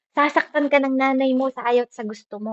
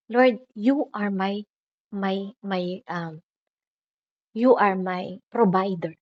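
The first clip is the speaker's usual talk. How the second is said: slow, softer praying voice; soft prayer; 5 dB quieter, slower than usual. Shown as a demonstration. A believer praying to the Lord, slow soft prayer. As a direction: pray gently and hesitantly.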